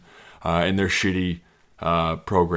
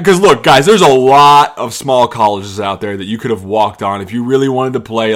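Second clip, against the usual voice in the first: usual speaking voice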